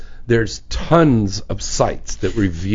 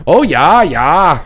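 louder, mock Minnesotan agreement; Minnesota accent; 4 semitones higher, 5 dB louder, much slower than usual. Host teasing co-host about who she hangs out with, Minnesota accent. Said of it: "somebody from Minnesota 'cause you're like".

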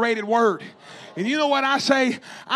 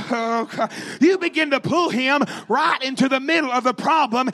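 ordinary talk

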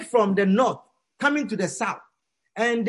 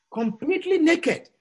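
ordinary talk, nothing marked

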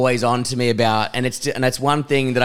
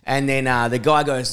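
usual speaking voice

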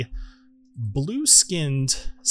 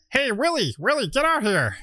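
higher-pitched call to a companion; 10 semitones higher than usual. Acted. Gnome calls Willie out of the tent, higher calling voice. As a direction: pitch it up to summon someone from a tent.

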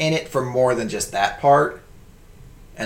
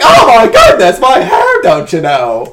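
very high, loud shrieking villain; hammed-up high squeal of a vain villain losing his hair; wacky Lex Luthor; 9 semitones higher, 12 dB louder, slower than usual. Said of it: "He's like".

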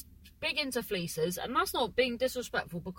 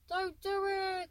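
pleading softly; 10 semitones higher, 4 dB quieter, slower than usual. Pretend. Pleading with a bird.

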